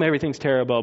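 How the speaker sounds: ordinary talk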